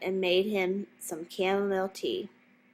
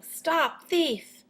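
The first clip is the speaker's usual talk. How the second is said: high, urgent call after a thief; angry gardener shouting stop thief; 8 semitones higher than usual. A narrator's reading. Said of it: "waving a rake and calling".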